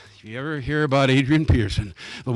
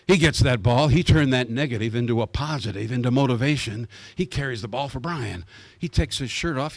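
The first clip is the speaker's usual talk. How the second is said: normal talking voice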